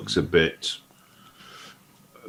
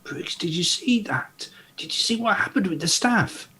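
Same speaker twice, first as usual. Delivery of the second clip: higher, hushed unvoiced whisper; excited whisper; 13 semitones higher than usual. Gully secretly tells Briggs about the floating staff in a whisper. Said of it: "Briggs and say".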